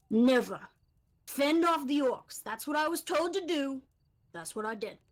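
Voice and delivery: oversized, bold voice from a tiny gnome; a small gnome who sounds far too big for himself; gnome's mismatched voice. Acted. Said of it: "with a voice that really doesn't seem like it fits".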